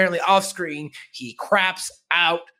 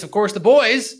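ordinary talk

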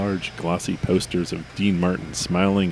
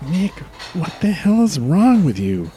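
exasperated; 11 semitones higher, 3 dB louder than usual. Acted. Exasperated coworker.

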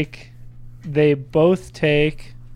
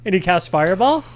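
normal talking voice

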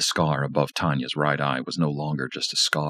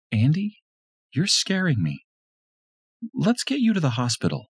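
higher, tense woman's voice; wife frightened by the bloody knife; frightened Lisa; 13 semitones higher than usual. A narrator's reading. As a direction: plead with him, scared.

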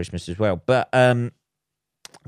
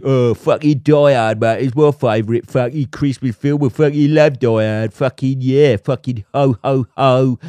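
louder, brash geezer voice, chest puffed; laddish Die Hard bore; 2 semitones higher, 5 dB louder than usual. Retold or imitated. Mocking the blokes who call it a Christmas film, brash loud geezer. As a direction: ham up the macho lad boasting. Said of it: "about the old fucking".

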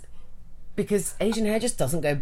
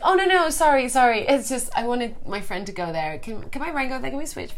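put-on apologetic girl; 7 semitones higher than usual. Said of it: "she's like".

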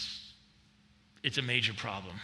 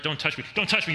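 1 panicky sheep; 5 semitones higher, 6 dB louder, faster than usual. Acted. Sheep voiced resisting shepherd.

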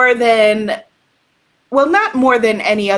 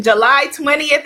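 normal talking voice

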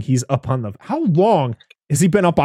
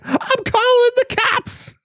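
very high, drawn-out comic screech; hammed-up threat, slow and very high; mock shriek; slower than usual. Neighbor catching Ed slashing, mock-shrieking.

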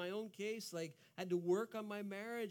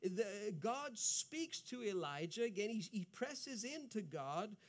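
normal talking voice

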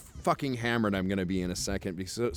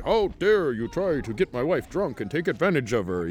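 over-the-top indignant accusation, raised pitch; loud mock outrage; 4 semitones higher than usual. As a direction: act scandalized, hammed-up and higher. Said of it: "he's like, loudly".

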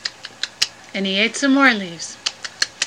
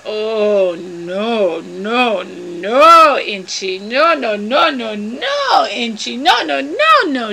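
exaggerated refusal; much slower than usual. Mel refusing Inchi's other branch, theatrically dismayed. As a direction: roll the noes out in swooping waves.

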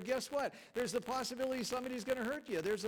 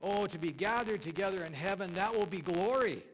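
quoted pastor exulting; 5 dB louder than usual. Retold or imitated. Preacher quotes a pastor's joke about heaven, exultant and loud. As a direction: swell with rapture up to the last word. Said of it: "And this pastor said".

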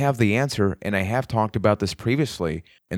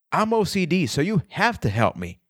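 high-pitched mock plea; mock your own excuse, starting high and sliding down; self-mocking; 7 semitones higher than usual. Shown as a demonstration. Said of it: "I am mocking myself there".

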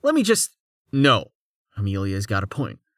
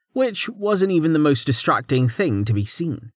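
plain reading voice

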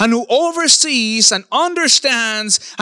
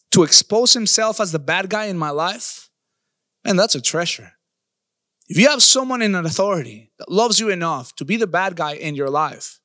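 ordinary talk

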